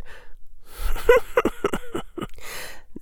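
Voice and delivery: breathy high chuckle from the dark; a thin sly chuckle; eerie high chuckle from behind the mist; high-pitched chuckle. A narrator's reading. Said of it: "A high-pitched chuckle".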